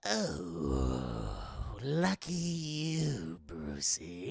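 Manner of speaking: sudden gasp, then low, slow sour drawl; hammed-up sore loser; mock-dismayed Joker. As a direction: pretend to be crushed by the loss. Joker feigns dismay at losing.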